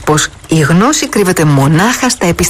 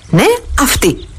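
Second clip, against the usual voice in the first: certain; 7 semitones higher than usual. Acted. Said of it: "είπε με σιγουριά".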